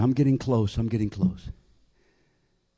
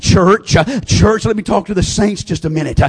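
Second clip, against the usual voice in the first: normal talking voice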